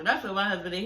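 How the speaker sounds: usual speaking voice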